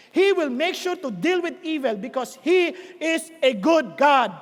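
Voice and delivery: slow, word-by-word declaration; emphatic preaching. Real, not acted. Preacher tells congregation God deals with evil, emphatic. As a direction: drive each word home slowly.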